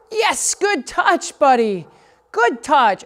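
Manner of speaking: high, slow, delighted praise; sing-song the reward up high to the dog; bright drawn-out praise for a good dog. Real, not acted.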